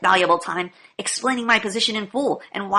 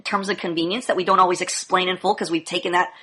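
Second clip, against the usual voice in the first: ordinary delivery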